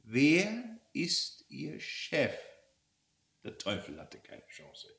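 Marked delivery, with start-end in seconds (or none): 0.0-2.3 very slow, word-by-word question; deliberate, drawn-out interrogation; slow, menacing repeat. Acted. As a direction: stretch each word like a predator cornering prey. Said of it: "wiederholte ihre Frage".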